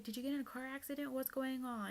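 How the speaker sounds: quick, high imitation of a frantic mother; panicked mom. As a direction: rattle off mom's panicked questions. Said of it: "she was like".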